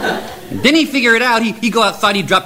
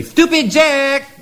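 drawn-out high call across the yard; 10 semitones higher, much slower than usual. Acted. Mommy calling her son from the house, long high call. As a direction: stretch the name out, calling him.

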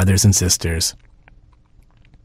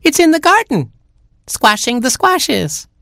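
very high squeaky little chick; a tiny baby chick whining. Acted. Baby chick complaining to parents, very high squeak.

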